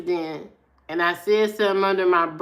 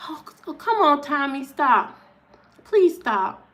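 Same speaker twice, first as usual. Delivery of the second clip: weary mom pleading; 4 semitones higher than usual. Retold or imitated. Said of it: "His mama said".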